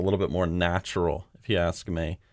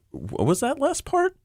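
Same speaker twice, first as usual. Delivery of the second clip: "What was that last part?" squeaked very high; shocked high question. Shown as a demonstration. Director demoing a shocked read, pitched very high. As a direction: shoot way up on part in disbelief. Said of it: "Or you could say".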